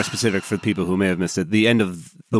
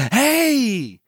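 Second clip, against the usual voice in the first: very high, slow syrupy greeting; hammed-up chummy old Texan; gushing Texas gentleman; 14 semitones higher, slower than usual. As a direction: overdo the friendly schmooze, way up high. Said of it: "he goes".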